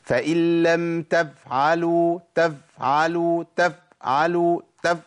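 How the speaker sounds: تفعلوا with the f cut off short and repeated; choppy wrong fa. Shown as a demonstration. Teacher demonstrating a cut-off f, choppy stutter. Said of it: "إن أنا أقول".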